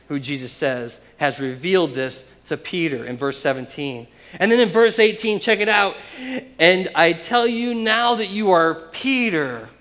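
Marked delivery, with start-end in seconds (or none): 4.4-9.7 raised, loud preaching delivery; excited proclamation. Real, not acted. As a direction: proclaim the verse high and loud. Preacher builds to the key verse.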